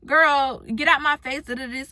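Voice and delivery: loud sassy brush-off; loud mock-angry girl, get out my face; mock angry snap. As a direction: snap at her to back off, loud. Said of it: "I would've been so angry and so upset".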